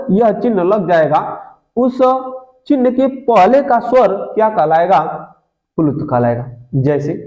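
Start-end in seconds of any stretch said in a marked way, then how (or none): none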